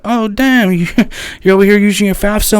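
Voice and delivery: retold surprised reaction; mock shock. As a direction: gasp at the waste.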